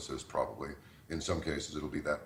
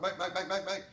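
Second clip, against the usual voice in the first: interrupting caller. Retold or imitated. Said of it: "I went".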